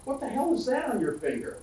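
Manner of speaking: retold blurt, pitched well up; mock astonishment of someone jolted from a doze; startled awake. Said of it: "Kenny jerks awake".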